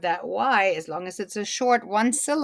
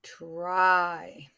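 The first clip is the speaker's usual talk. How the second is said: drawn out; 2 semitones lower than usual. Shown as a demonstration. Teacher repeating the word for class, TRY drawn out. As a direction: TRY stretched through the vowel. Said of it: "it's short".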